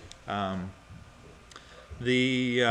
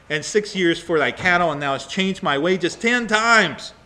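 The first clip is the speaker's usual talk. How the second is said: angry complaint; 3 semitones higher, 5 dB louder than usual. Acted. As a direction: hammer home the grievance as wronged Jacob. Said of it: "not real happy here".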